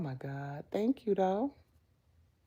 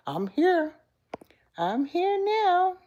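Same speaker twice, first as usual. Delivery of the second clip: reassuring; 12 semitones higher, 4 dB louder, slower than usual. Real, not acted. Reassuring Janet, high and slow.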